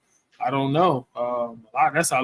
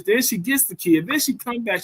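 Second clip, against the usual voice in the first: normal talking voice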